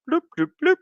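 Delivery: very high little bloops; playful bloop noises. Pretend. Streamer clicking through the post, high playful bloops. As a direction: pip out three high bloops.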